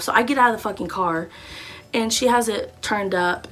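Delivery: ordinary delivery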